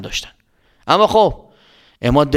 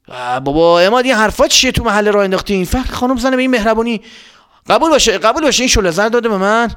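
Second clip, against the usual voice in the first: put-on higher, fast scolding old man; high hurried dad voice; Mohammad's dad; 7 semitones higher than usual. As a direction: scold the kid quickly, high.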